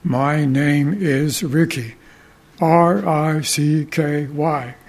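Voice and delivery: slow, deliberate parakeet imitation of a deep voice; mimicked deep voice. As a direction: talk like a parakeet copying a deep-voiced pastor, drawn out. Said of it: "the parakeet mimicked that deep voice".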